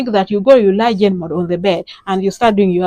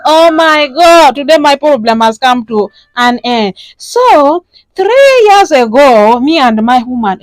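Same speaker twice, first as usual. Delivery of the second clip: loud, drawn-out, dramatic caller; distressed caller; 4 semitones higher, 5 dB louder than usual. Acted. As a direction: pour out your troubles, hammed up. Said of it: "those are the kind of calls I get".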